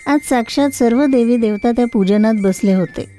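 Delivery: plain reading voice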